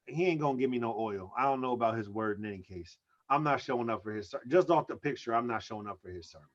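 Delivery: low aside. Real, not acted. Low muttered aside about the preacher.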